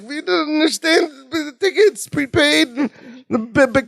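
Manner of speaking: high, crying whine; whiny little plea. Retold or imitated. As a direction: whimper it out like a child denied a treat. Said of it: "great whiny little thing".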